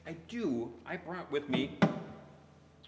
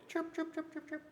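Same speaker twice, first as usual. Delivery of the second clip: high, soft chirping; cricket chirp; 6 dB quieter than usual. Acted. Storyteller doing the cricket's chirps for kids, high and soft. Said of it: "The cricket who chirps".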